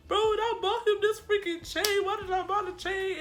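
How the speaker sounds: high, loud comic whine; mock wailing lament. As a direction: wail over wasted money, half joking. Retells crying and joking lament.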